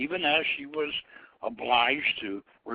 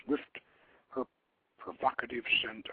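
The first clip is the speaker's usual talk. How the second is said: ordinary delivery, nothing marked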